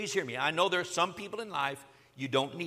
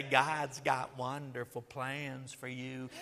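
slow, smooth, smiling promise; grinning smooth preacher; 6 semitones lower, 6 dB quieter, much slower than usual. Retold or imitated. Imagined suited preacher grinning at me, slow slick charm. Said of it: "look at me and grin and say".

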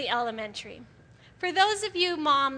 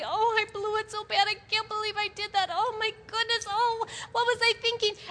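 higher, fretful moaning; mock self-pity wail; 5 semitones higher than usual. A woman in her pity party, mock moaning. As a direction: whine like someone wallowing in pity.

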